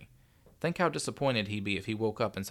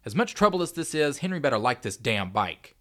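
exasperated; 5 semitones higher, 8 dB louder than usual. A narrator's reading. Frustrated dad assembling a stubborn bike.

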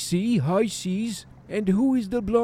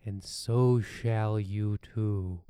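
ominous narration; 8 semitones lower, 7 dB quieter than usual. Acted. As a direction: speak it low like a dark secret. Ominous intro over music.

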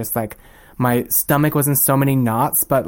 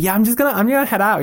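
higher-pitched casual announcement; breezy excuse; 9 semitones higher, much faster than usual. Retold or imitated. Boss excusing himself to leave them alone, breezy excuse. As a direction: toss it off airily, pitched up. Acting out boss slipping away.

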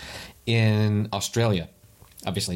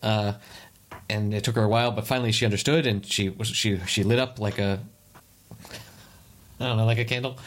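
ordinary delivery

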